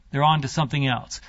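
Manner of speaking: usual speaking voice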